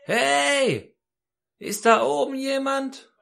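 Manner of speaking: very high, long-drawn call upward. Retold or imitated. Hanging man got no answer and calls up, urgent high call. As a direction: stretch the cry so it carries up the cliff. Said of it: "rief er natürlich etwas lauter".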